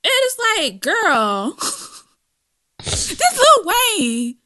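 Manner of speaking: slow, high laughing words. Real, not acted. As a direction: drag it out while laughing. Cracking up about [name].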